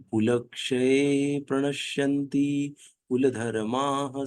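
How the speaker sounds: slow, measured Sanskrit chanting; verse recitation. Acted. Teacher chanting a Gita verse to the class, slow and measured. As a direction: draw each syllable out like a sacred verse.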